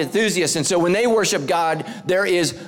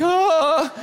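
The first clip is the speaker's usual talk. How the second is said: mock shocked gasp; 11 semitones higher than usual. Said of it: "all the Catholics are like".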